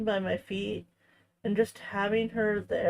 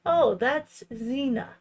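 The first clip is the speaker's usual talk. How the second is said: nice shelter lady; 3 semitones higher, slower than usual. Retold or imitated. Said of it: "the nice adoption shelter lady was like".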